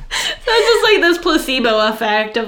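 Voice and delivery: words breaking up with laughter; laughing. Real, not acted. Fan laughing while recounting the placebo joke, laughing.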